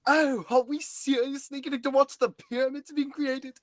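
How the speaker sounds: eager rising wonder; excited. Acted. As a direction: gush like a history buff getting a dream come true. Thrilled at seeing history.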